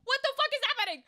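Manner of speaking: high, fast disbelieving yelp; shocked outburst. Retold or imitated. Streamer recalling her reaction to the DM, shocked yelp. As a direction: blurt it out in sudden disbelief. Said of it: "I was like".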